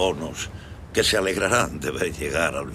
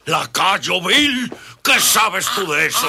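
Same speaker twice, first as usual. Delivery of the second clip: furious shout; 8 dB louder, slower than usual. Acted. Count furious at servant.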